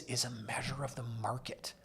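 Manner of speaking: soft whispered aside. Acted. Explaining the home price index to viewers, whispered. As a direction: whisper it like a secret.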